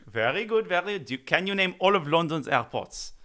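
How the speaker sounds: mock French accent, playful. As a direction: put on a joking French accent for the place names. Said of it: "you said London, but in a French accent".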